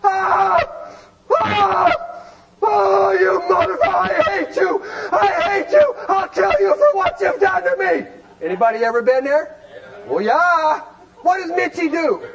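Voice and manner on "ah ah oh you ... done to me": screaming in labor. Retold or imitated. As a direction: scream it like a woman giving birth. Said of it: "And she's screaming".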